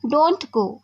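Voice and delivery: usual speaking voice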